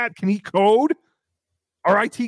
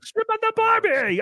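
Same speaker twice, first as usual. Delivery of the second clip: high, slow mock Australian drawl; mock Australian accent, pitched way up. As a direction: ham up an Australian tourist cliché. Mocking Australian phrase.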